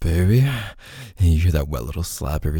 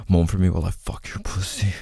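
the voiced "Moan for me" repeated as a breathy unvoiced whisper. Lover to partner, the same line in a whisper. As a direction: drop the voice to pure breath on "Moan".

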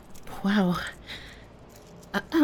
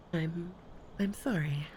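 whispered apology; 8 dB quieter than usual. Acted. Apologising to an angry partner.

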